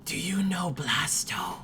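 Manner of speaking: unvoiced secretive whisper. Girl whispering a secret to Anar, voiceless. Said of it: "whispers at you and says".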